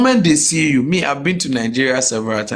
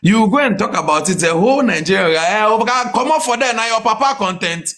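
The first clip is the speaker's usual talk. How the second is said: mock Nigerian defender; 6 semitones higher than usual. Mocking Nigerians defending a thief.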